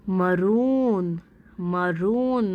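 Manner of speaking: मरून very slowly, oo drawn out; slow teacher's मरून, long oo. Shown as a demonstration. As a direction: stretch the second syllable of मरून. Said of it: "long है".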